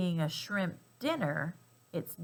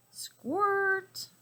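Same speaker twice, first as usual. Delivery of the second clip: squeaky squirt; 13 semitones higher than usual. Acted. Sound effect repeated, baby elephant.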